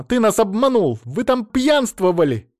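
high, loud indignant tirade; screamed accusations. A narrator's reading. Mother-in-law accuses him of deceit and feasting, shrieked tirade. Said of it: "закричала она".

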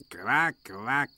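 mock duck quack, odd timbre, rising. Donald teasing Duck with a mock quack.

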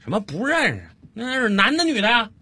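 impatient dad calling. Acted. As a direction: call out impatiently to your child. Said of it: "在厨房里头又冲着儿子喊了一句".

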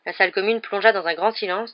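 plain reading voice

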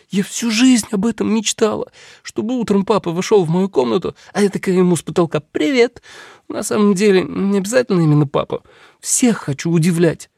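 put-on high, eager girl voice; bubbly girl dreaming aloud; excited young princess.

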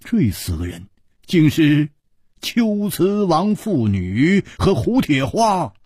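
usual speaking voice

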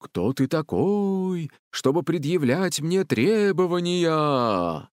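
imperious booming challenge. A narrator's reading. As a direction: draw each word out like a forest spirit. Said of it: "Зоя проговорила низким голосом". (грозный протяжный голос)

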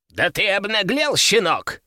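put-on high-pitched old collector's outrage; indignant growl. A narrator's reading. Old collector scolds the young rival, indignant growl. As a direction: snarl at the young upstart. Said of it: "рыкнул на меня старик-коллекционер".

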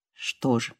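low, soft, faint reply; weak wounded man. Acted. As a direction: barely voice it, exhausted. (слабо, тихо и низко)

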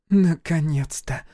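slow, sighed-out word; long-awaited relief on an outbreath; exhaled relief. A narrator's reading. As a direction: breathe it out, drawn out. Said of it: "выдохнула Гюрза".

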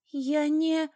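high, strained, cut-off plea; frightened protest. A narrator's reading. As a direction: start to protest in panic, then break off. Cornered captive falters.

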